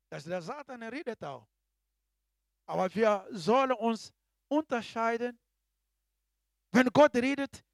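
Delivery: loud fiery sermon delivery; preaching at full force. Acted. Preacher warns the congregation about Satan, fiery preaching.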